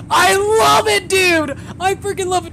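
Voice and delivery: very high, loud squeal of delight; ecstatic yell. Real, not acted. Gamer thrilled by victory dance, ecstatic yell.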